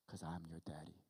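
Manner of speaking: hushed, low punchline; tender reassurance. Acted. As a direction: say it gently as a loving father. God's answer as solemn punchline.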